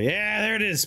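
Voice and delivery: high excited yelp of triumph. Real, not acted. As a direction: yell it, thrilled. Sees water hit lava.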